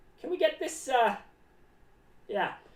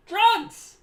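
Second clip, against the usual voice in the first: higher and loud, one blurted word; shouted answer; 8 semitones higher, 8 dB louder than usual. Real, not acted. Clown answering own question, loud and sudden. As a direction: blurt it out like a game-show answer.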